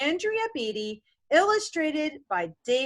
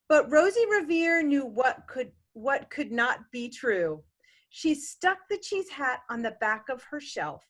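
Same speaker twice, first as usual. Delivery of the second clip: rhythmic picture-book reading; gentle bedtime-story reading; story narration; 3 semitones lower than usual. A narrator's reading. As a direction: read the rhyme to a child. Parent continues the reading.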